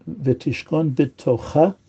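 slow, with final CHA of B'Tocha stressed; stress on last syllable. Shown as a demonstration. Teacher demonstrating the mapik hey, last syllable stressed in B'Tocha. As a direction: land the beat on the end of B'Tocha. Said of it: "that's also for emphasis".

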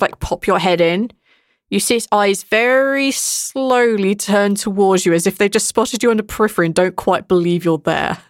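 ordinary talk

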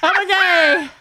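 put-on slipping into a playful Irish accent. Cohost slips into a joke Irish accent on the podcast. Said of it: "I'm slipping back into this Irish accent again".

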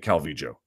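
Calvillo with the double L as an English J. Shown as a demonstration. Telling critics he's not wrong, J-sound Calvillo. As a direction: say Calvillo as "cal-VEE-jo". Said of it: "I'm not wrong for pronouncing it".

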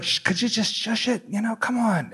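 high, soft plea to be quiet; hushed shushing. Retold or imitated. Shushing talkers in the cinema.

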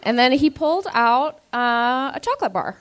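usual speaking voice

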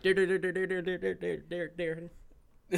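high, rapid auctioneer rattle. Retold or imitated. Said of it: "He's like an auctioneer".

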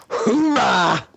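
high triumphant cheer. Acted. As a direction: whoop it like a win. Cheering after stealing thunder.

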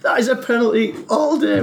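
lightly imitating Bernie's outrage; excited quote. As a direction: call it like an outraged pundit. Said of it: "he was like".

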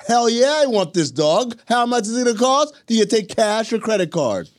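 hammed-up eagerness, as if dying to pay; eager adopter. Mocking a keen adopter while arguing with cohost, hammed-up excited. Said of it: "You want someone who's like".

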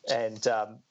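normal talking voice

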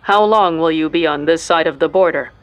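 standardized machine-filtered voice; border guard voice. A narrator's reading. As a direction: speak like a processed checkpoint voice. Border guard voice through algorithm.

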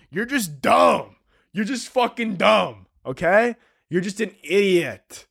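high, drawn-out taunting; mock contempt. Mock insults, joking contempt.

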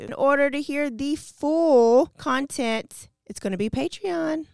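ordinary talk

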